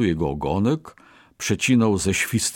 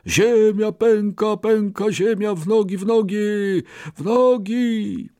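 panicked shout; 12 semitones higher than usual. Acted. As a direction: yell it while running away. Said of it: "wykrzykując". (panicznie krzyczane w biegu)

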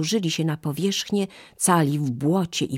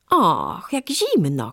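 monkey crying out; 3 semitones higher, 4 dB louder, slower than usual. Acted. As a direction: yelp it out as the spray hits. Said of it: "krzyknęła małpa".

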